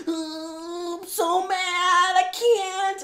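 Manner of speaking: high sing-song mock whine; mock Danny as a whiny baby. Friend mocks Danny Rand's whining in a high sing-song whine. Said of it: "a whiny baby man".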